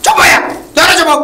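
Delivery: high, loud order to shut up; exasperated shout. Acted. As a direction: yell over both of them to silence them. Elder silences two quarrelling villagers.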